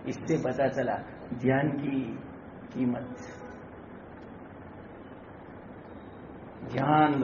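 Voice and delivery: low, soft preaching with long pauses; hushed exhortation. Real, not acted. Preacher urging followers not to live carelessly, hushed. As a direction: counsel them quietly and gravely.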